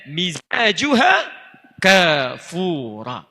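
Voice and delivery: fast Arabic sermon delivery, slowing at the verse; Arabic preaching. Acted.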